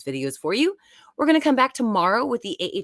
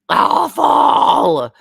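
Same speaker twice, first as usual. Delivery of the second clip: mock shout; 8 semitones higher, 11 dB louder than usual. Mock outrage at season ten.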